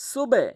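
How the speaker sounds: "সুবে" high, loud, bounced on the B; forceful released B in "সুবে"; downward push. Shown as a demonstration. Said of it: "নিচের দিকে এরকম ধাক্কা দিতে হবে".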